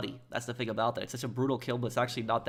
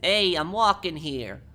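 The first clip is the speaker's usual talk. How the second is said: loud, slow, higher Italian-American "Hey, I'm walking here"; slow, loud Italian accent, pitched higher; 6 semitones higher, 6 dB louder, slower than usual. Shown as a demonstration. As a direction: belt it out like an Italian guy stepping off the curb. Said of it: "for the Italian accent".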